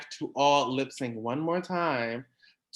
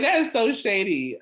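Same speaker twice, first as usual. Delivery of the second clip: mock shade; 8 semitones higher, 5 dB louder than usual. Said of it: "I was like".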